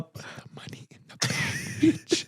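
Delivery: hushed threat, all breath, last word popped out; whispered robbery demand. Retold or imitated. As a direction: parody ASMR robber whisper. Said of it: "but everything was whispered".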